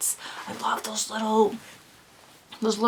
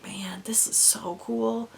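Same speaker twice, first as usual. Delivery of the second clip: slow hushed whisper; whispered aside; 7 dB quieter than usual. Retold or imitated. Cashier gushing to herself about the yarn, slow whisper. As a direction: keep it a secret under your breath. Hiding her reaction from customer.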